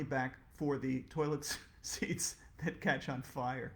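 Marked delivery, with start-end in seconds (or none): none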